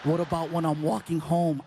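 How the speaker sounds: put-on low, loud voice of an arguing teen; teenage son. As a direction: imitate a son pushing back.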